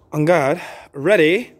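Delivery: brisk referee calls, clipped and firm; crisp fencing commands. Acted. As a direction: call the bout like a referee.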